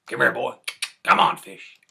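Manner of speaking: loud high call to the fish. Retold or imitated. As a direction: call him across the yard, loud. Jack calls the fish again.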